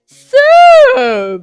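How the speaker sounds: long, loud, high drawn-out word; stretched indignant yell; confrontational shout. Acted. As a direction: draw it out loudly, accusing. Boss rounds on Vincent.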